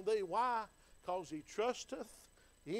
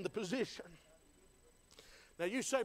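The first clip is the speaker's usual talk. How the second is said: ordinary delivery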